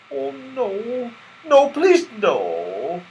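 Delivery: a touch of frightened pleading. Acted. Silky begging Santa not to keep her, mildly frightened.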